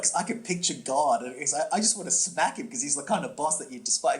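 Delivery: usual speaking voice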